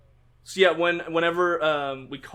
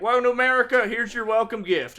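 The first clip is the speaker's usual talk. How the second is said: mock announcer; 6 semitones higher, 3 dB louder than usual. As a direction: hand it over like a cheesy host.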